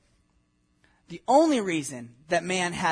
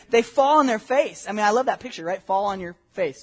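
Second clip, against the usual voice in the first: usual speaking voice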